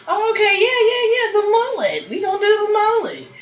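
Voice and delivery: high excited mock Heidi voice; imitating Heidi. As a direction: squeal it like an eager contestant. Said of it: "she tried to pull a Heidi".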